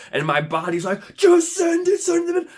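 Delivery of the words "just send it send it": high, loud acted shout; frantic urging. Body begging him to bid, frantic urging. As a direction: urge it on in a high mock panic.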